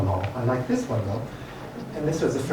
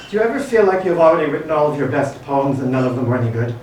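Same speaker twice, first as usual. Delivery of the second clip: louder, projected list-poem recital; poetry reading; 3 semitones higher, 5 dB louder than usual. Real, not acted. Poet reading a list poem to a café audience, poetry recital. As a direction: recite each numbered question to the room.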